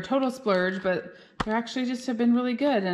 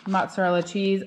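ordinary delivery

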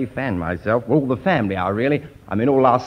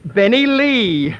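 triumphant recall; 9 semitones higher, 5 dB louder, much slower than usual. Retold or imitated. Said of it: "He said".

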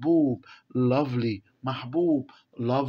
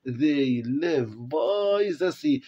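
slow reading aloud; much slower than usual. Shown as a demonstration. Teacher reading the text to the class, very slowly. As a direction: read each English word very slowly for the class.

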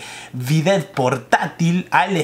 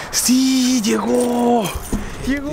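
excited; 6 semitones higher, much slower than usual. Real, not acted. Package finally arrived, excited.

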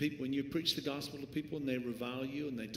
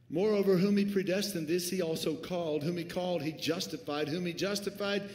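louder, declaiming the verse; forceful scripture reading; 3 semitones higher, 5 dB louder than usual. Real, not acted. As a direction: proclaim each clause.

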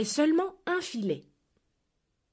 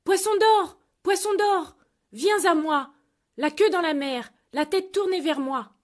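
high, loud call out to sea; 6 semitones higher, 4 dB louder than usual. Acted. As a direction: summon the fish across the water. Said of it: "courut au bord de la mer et cria".

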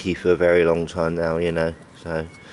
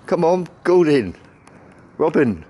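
high, loud coaxing call; 7 semitones higher, 3 dB louder than usual. Real, not acted. Calling the pigeons down to feed, coaxing calls. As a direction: call each bird in by name.

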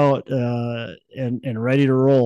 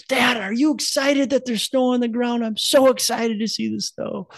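excited little son; 13 semitones higher than usual. Retold or imitated. Son telling his dad about the snow, high excited kid voice. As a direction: play the little boy amazed by snow. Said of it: "comes downstairs".

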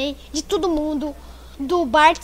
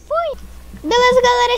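upbeat outro; 8 semitones higher, 4 dB louder than usual. Real, not acted. Vlog outro greeting.

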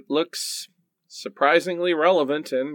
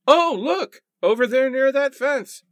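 high, bright call of discovery; excited discovery; 10 semitones higher, 3 dB louder than usual. Acted. Thomas spots an object near the fence, excited. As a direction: point it out eagerly, pitched up. Spotting something in the snow.

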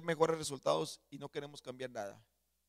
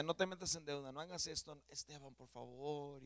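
pleading wife; 7 dB quieter than usual. Retold or imitated. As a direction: mildly imitate a wife begging her husband. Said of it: "ella siempre me decía".

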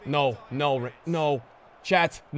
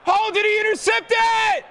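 angry shout; 6 semitones higher than usual. Real, not acted. Opponent intercepts, yelling.

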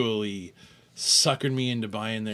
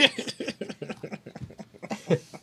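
high airy giggle; breathy laugh; 10 semitones higher, 5 dB quieter than usual. Real, not acted. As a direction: break into laughter. Laughing at the joke.

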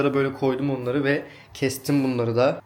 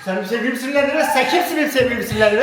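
high, fast hammed-up threat, laughing; over-the-top protective partner; mock jealous tough; 10 semitones higher, faster than usual. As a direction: play the jealous boyfriend.

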